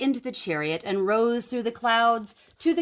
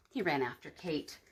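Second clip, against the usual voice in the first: usual speaking voice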